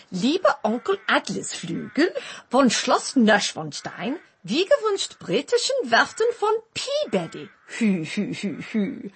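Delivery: reading aloud in hammed-up German; mock-German letter reading; German accent. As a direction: put on a German accent, deliberately paced. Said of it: "Is this about the German accent?".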